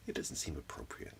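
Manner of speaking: ordinary talk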